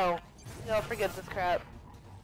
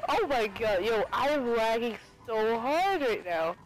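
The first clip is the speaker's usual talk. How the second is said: usual speaking voice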